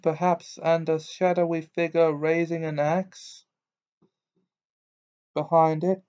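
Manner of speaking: a hint of a mystical teacher coaxing; misty seer Trelawney. A narrator's reading.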